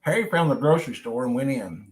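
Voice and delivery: plain reading voice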